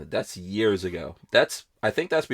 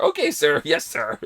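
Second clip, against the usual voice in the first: mock polite sir; 4 semitones higher than usual. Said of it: "They kept calling her".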